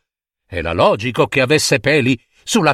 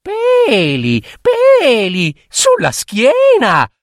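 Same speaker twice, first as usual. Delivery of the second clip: very high mocking child voice with giggles; high sing-song teasing rhyme; teasing little girl; 9 semitones higher, slower than usual. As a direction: taunt the monster cheekily. Girl's taunt.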